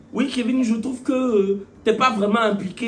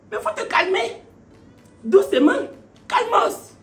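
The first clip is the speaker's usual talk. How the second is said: much higher, teasing calm-down; mock calming; 11 semitones higher than usual. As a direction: tell her to cool it in a high voice. Conseil moqueur à la camarade. (appel au calme moqueur, voix très aiguë)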